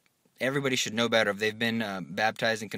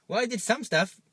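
higher, sheepish excuse; 8 semitones higher than usual. Pretend. Soul before the Lord making a weak excuse. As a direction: squeak out a lame excuse.

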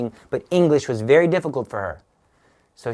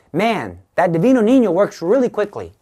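higher, louder, impressed exclamation; amazed quote; 7 semitones higher, 6 dB louder than usual. Retold or imitated. Mother Angelica marveling at a quick answer, amazed. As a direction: retell it as marveling out loud.

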